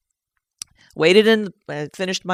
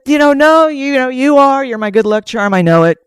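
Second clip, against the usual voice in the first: loud, raised, emotional insistence; acted-out fervent encouragement; emphatic reassurance; 6 semitones higher, 9 dB louder than usual. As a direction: insist on it with a swell of feeling. Acting out her emotional reassurance.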